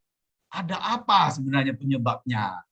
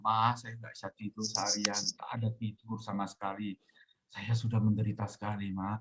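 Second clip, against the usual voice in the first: low, soft retold plea to a mother; understated near-crying, voice dropped and quietened; near tears, retold; 5 semitones lower, 8 dB quieter than usual. Sleepless son confiding in his mother, near tears. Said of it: "hampir nangis".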